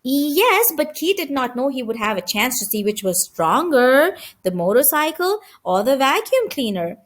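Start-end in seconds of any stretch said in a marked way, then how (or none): none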